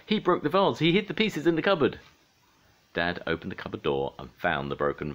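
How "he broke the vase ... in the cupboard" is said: put-on loud, high-pitched child's outburst; shouted tattling. A narrator's reading. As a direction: blurt out the accusation at a shout. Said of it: "shouted Pinga".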